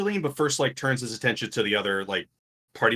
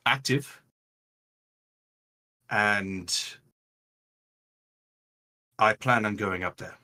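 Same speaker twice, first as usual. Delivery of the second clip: lightly voiced leader briefing the group; deliberate in-character delivery, long pauses between phrases; Raylan, measured and sober; 4 semitones lower than usual. Raylan thanks the party and lays out plans, measured and sober.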